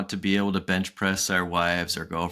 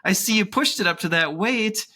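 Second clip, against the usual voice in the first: upbeat coach stepping in after a heavy set; 14 semitones higher than usual. Retold or imitated. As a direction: talk like a chummy trainer reining you in. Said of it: "have coach come in and say".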